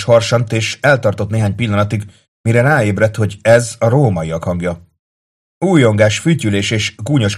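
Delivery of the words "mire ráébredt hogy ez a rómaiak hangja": ordinary delivery, nothing marked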